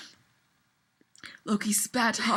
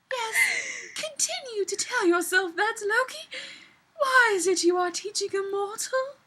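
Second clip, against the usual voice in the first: much higher, knowing elf voice; teasing elf; 10 semitones higher than usual. Acted. Elf woman teasing Loki about his pupil, high knowing voice. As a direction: needle him playfully.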